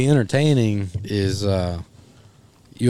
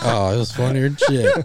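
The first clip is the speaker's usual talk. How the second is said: ordinary talk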